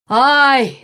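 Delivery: high yelp of fright; cried-out "ai". A narrator's reading. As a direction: yelp out in alarm. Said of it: "закричал человек". (испуганный вскрик)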